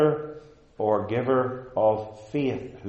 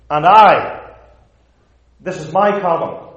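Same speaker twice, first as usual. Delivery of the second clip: "And I" punched loud and higher; forceful, higher-pitched quote of God; emphatic; 4 semitones higher, 9 dB louder than usual. Retold or imitated. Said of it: "God is emphatic on this point".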